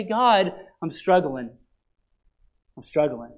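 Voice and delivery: a broken confession whispered to God; humble prayer. Pretend. Believer humbly confessing struggle to God, soft and low. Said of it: "humble yourself before him and say".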